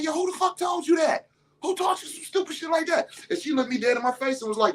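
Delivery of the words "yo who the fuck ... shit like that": much higher, shouted outraged demand; angry shout. Retold or imitated. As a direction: burst through the door yelling. Father bursts in on daughter.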